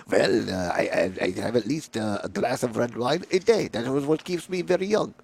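lightly put-on French accent, low; a hint of an old Frenchman. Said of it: "I have a terrible French accent".

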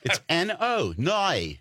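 high, slow, exaggerated Australian vowels; Australian accent. Australian insisting on the word no, hammed-up Australian accent. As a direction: whine "no" as "noy" in a mock Australian accent. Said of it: "like, Australian people, when they say no".

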